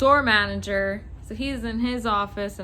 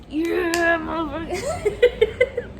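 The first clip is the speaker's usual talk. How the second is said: high, slow, drawn-out mock triumph; gloating; 6 semitones higher, much slower than usual. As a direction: crow it up high, breaking into a laugh. Gloating as boss defends her.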